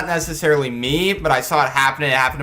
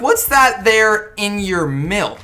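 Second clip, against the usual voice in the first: slow, raised mock old-lady interrogation; mean old lady voice; 4 semitones higher, 4 dB louder, much slower than usual. Lunch lady questioning kids about their milk, mock mean old lady. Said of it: "this really natty old lady mean voice".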